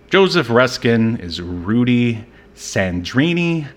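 ordinary delivery

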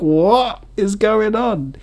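high, slow baffled cry; squeaky stunned question; mock bewildered. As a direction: act overwhelmed and confused. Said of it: "I was like".

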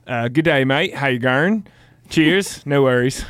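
clumsy stab at Aussie greeting; badly faked Australian accent; American trying out Aussie; attempted Australian accent. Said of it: "you're gonna say it in Aussie".

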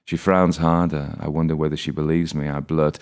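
usual speaking voice

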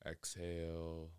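measured, settled delivery, letting each phrase hang in silence; guided meditation. Real, not acted. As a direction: guide listeners calmly inward, phrase by unhurried phrase.

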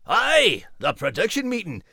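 teasing about the big shots; Max voice. Acted. Max teases the announcer, mock-grand Scottish lilt.

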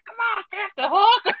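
hammed-up cartoon sidekick plea; mock Smee voice. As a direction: squeak it like a bumbling pirate sidekick. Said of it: "He's like".

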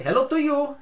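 high, loud imitated Portuguese greeting; thick Portuguese accent. Imitating the manager coming in to say hello, thick Portuguese accent. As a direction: boom it out in a mock Portuguese accent. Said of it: "That was how he sounded".

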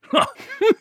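booming high knightly laugh; hearty guffaw. Acted. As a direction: let out a big belly laugh. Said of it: "laughed heartily".

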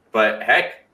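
high, loud fed-up venting; exasperated outburst. Real, not acted. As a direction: throw up your hands, loud and high. Fed-up outburst about team.

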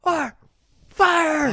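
heated firing. Retold or imitated. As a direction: bellow it like a furious boss. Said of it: "walking away all heated".

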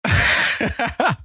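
high-pitched burst of laughter; high giggle. Real, not acted. Laughing at the memory.

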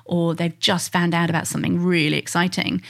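ordinary delivery

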